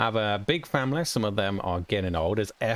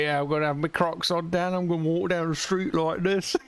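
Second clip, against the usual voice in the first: higher, lightly voiced boastful kid; imitating the kid; 6 semitones higher than usual. Streamer imitating his kid showing off the Crocs walk. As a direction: show off like a kid with new shoes. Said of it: "He was like".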